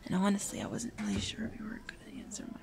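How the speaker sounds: hushed, rapid nervous rambling; very soft, hurried mumble; nervous mumbling. Acted. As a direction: babble anxiously under your breath, words tumbling out. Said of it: "I'm mumbling again".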